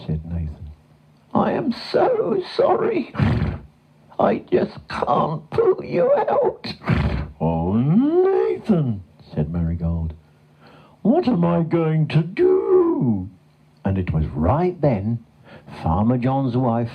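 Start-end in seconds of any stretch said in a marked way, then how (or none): 1.3-6.7 very high, slow whinny; apologetic horse. A narrator's reading. Horse giving up on pulling the cow, sorrowful whinny. 7.4-9.0 high, slow drawn-out wail; despairing cow. A narrator's reading. Stuck cow to the failing horse, despairing. 11.0-13.3 high, slow wail; despairing cow. A narrator's reading. Stuck cow asking the horse, despairing.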